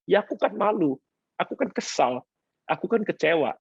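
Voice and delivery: mock hurt wife. Pastor voicing hurt wife.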